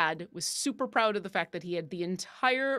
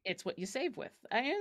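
ordinary talk, nothing marked

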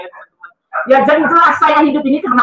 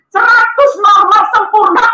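high, slow amazed proclamation; astonished announcement; 8 semitones higher, 4 dB louder, slower than usual. Retold or imitated. Nurse proclaiming the result to her patient, astonished announcement. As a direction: draw out each word in amazement. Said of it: "Dia kaget-kaget luar biasa".

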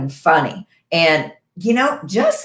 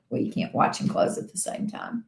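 whispered aside; 6 semitones lower, 10 dB quieter than usual. Real, not acted. Whispered aside during breathing exercise.

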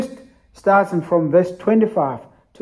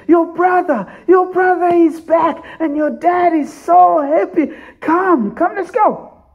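excited shout; 12 semitones higher, 4 dB louder than usual. Acted. Servant calls the brother in.

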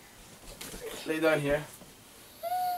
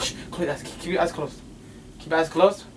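normal talking voice

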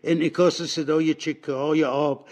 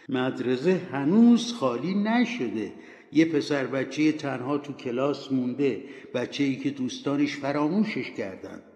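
ordinary delivery, nothing marked

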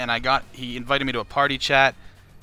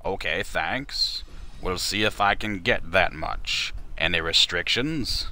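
slow, lower Morgan Freeman voice; calm Morgan Freeman read; 5 semitones lower, slower than usual. Pretend. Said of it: "I'll Morgan Freeman it as the guy".

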